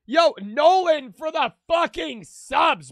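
loud, high and slow hype yell; hype shout. Real, not acted. Streamer thanking Nolan for gifted subs, shouting.

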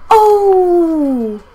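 high loud cry of surprise; surprised shout. Real, not acted. Gamer yelling as his striker scores. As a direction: yell as the ball goes in.

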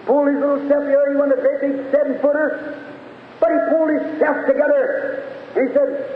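high, sustained preaching chant; full-force preaching. Real, not acted. Preacher describing Jesus at Lazarus's grave, full-force preaching.

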